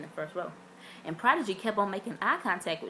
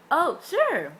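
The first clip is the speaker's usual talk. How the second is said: high, louder cheerful reply; imitated bright reply; 9 semitones higher, 8 dB louder than usual. Princeton agreeing to a hug, bright and loud. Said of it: "He was like".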